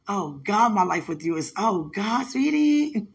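loud rising gush ending high; overjoyed. Real, not acted. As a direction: let the joy burst out. Overwhelmed with joy.

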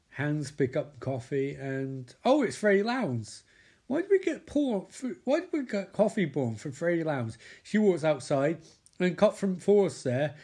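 ordinary talk, nothing marked

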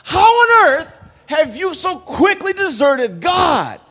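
high, loud, shocked rebuke; harsh scolding at the top of the voice; stern, outraged Paul. Acted. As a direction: shout it in stunned indignation, stretching the key words. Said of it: "ものすごい厳しい口調でですね".

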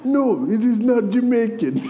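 high-pitched, badly faked Indian-Italian accent; botched Indian-Italian accent. Defending his accent attempt to her, botched Indian-Italian accent. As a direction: protest in a muddled mock Indian-Italian accent. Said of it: "trying to do an accent where I was trying to be Indian and, or Italian".

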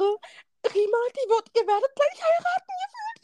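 high-pitched, climbing excited retelling; excited announcement. Retold or imitated. Friend retelling the news of an imminent wedding, excited.

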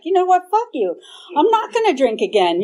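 loud, high defiant snap; angry retort. Retold or imitated. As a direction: spit it back at him, fed up. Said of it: "but I also got mad".

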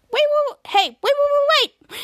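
high, loud rapid waits; hammed-up frantic plea; urgent calling out. Stranded kids call after hero.

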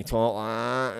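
lightly imitated addict's slack, drawn-out drawl; heroin addict's drawl. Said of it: "that's how they talk".

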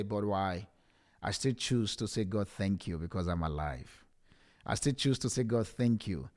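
low, subdued, heartfelt testimony; emotional. Real, not acted. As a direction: speak softly and low, weighed down by feeling. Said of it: "the reason why I am sounding like this".

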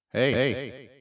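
auto-tuned greeting; hard tune effect. Shown as a demonstration. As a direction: call out through hard tune. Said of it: "this is what hard tune sounds like".

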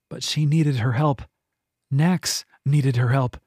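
plain reading voice

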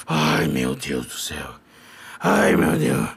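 low, slow hammed-up moaning; mock lament to God; despairing groan. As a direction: sink into comic despair. Despairing after the quarrel.

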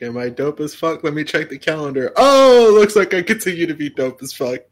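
cocky Nintendo voice. Retold or imitated. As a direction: brag like a company full of itself, ending in a loud whoop. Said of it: "Nintendo's like".

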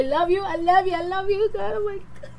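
getting emotional. Real, not acted. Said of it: "Get all emotional".

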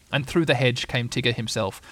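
usual speaking voice

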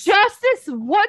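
high, loud, drawn-out mock echo; exasperated mock repeat. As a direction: throw the line back, loud and incredulous. Throws quoted line back, exasperated.

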